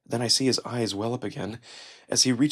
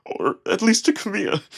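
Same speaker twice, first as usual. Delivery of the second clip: voice breaking. A narrator's reading. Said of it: "His voice breaks".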